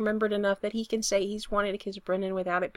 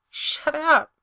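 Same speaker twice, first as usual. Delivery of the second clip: higher, louder, miserable retort; 6 semitones higher, 7 dB louder than usual. A narrator's reading. Brendan stopping Spencer's confession, miserable. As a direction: cut him off, miserably. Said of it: "Brendan says miserably".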